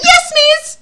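very high, loud pupil's squeak; mock-obedient pupil. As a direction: squeal it like an over-eager schoolgirl. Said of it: "I was like".

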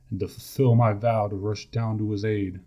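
ordinary delivery, nothing marked

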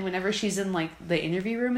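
ordinary delivery

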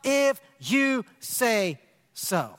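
very slow and deliberate; simple faith response. Shown as a demonstration. Preacher modelling simple faith, very slowly. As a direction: savour each word, gently. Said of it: "just that simple faith response".

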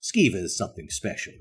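usual speaking voice

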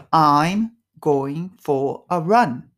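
very slow, careful English model; slow model phrase. Shown as a demonstration. Teacher models the phrase for students to repeat. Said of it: "repeat after me".

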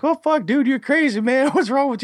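very high squeaky imitation of alarmed friends; mock shocked players. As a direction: mimic freaked-out onlookers way up high. Acts out shocked players' reaction.

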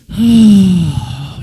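loud breathy sigh; big exhale. Shown as a demonstration. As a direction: let the anger out in one big breath. Demonstrates catching your breath.

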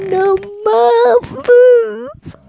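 „fünf“ viel höher und lauter, steigend; quietschige Ansage. Shown as a demonstration. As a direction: quietsch „Nummer fünf“ als Gag. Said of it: "gesungene Ansage". („Nummer fünf“ albern hoch gequietscht)

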